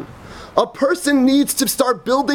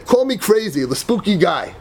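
ordinary delivery

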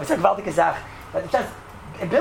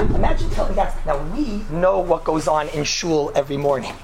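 ordinary talk, nothing marked

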